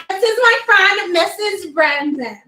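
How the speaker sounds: high, posh-toned introduction, laughing; laughing as she presents Missus Brandon grandly; fancy rich-sounding name. Retold or imitated. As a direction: say it like money. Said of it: "Did she just sound like she has money?".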